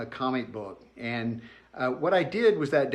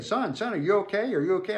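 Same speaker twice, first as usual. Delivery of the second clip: a lightly voiced concerned man, pitched up; higher, urgent son are you okay; worried stranger; 7 semitones higher than usual. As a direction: ask anxiously, like a stranger who just hurt a kid. Stranger voiced checking on hurt boy.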